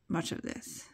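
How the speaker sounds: ordinary delivery, nothing marked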